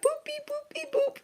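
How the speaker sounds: tiny high robotic boops; high beeping noises. Retold or imitated. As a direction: chirp it like a cartoon machine. Imitating influencers' injection sounds.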